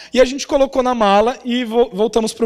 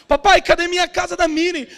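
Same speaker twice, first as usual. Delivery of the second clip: lightly voiced little girl, higher pitch; impatient child; 6 semitones higher than usual. Dad mimics daughter asking for her present, impatient.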